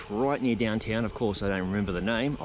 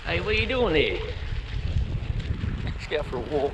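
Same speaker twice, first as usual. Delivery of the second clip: calling a dog; 3 semitones higher than usual. Real, not acted. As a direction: talk up to the dog.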